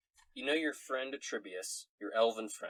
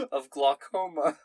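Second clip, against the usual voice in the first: Scottish brogue breaking into loud, slow delivery; Scottish accent; 4 semitones higher, 10 dB louder than usual. Acted. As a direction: struggle through it in Scots, trying not to laugh. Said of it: "read glaucoma in a Scottish accent".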